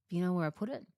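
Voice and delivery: ordinary talk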